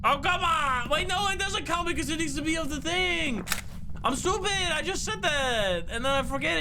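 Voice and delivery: exasperated gamer shouting at own mistake; frustrated outburst. Real, not acted. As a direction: yell at yourself for the blunder.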